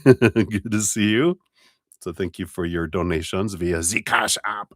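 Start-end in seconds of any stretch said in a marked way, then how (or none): 3.5-4.7 playful mock German accent; hammed-up bit of German accent. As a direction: put on a semi-good German voice. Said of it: "I have to go into my German voice or my semi-good, excuse me, uh, German voice".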